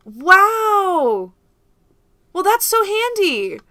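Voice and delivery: high, delighted wow; bright excited exclamation. Real, not acted. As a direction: gush over the gadget. Delighted by the meter.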